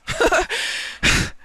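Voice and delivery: a high, breathless burst of laughter at himself; embarrassed laugh. Real, not acted. Streamer laughing at his own heated rant, high breathless laugh. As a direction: laugh off your own outburst.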